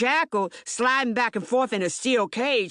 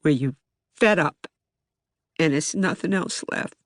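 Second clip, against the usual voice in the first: weary and worn down; 5 semitones lower than usual. Real, not acted. Telling how life in Baltimore wears you down, weary. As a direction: speak like someone worn out by it all.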